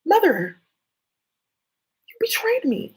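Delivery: high, loud wounded protest; betrayed child voice. Acted. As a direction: cry out at mother, hurt. Acting a betrayed child to mother.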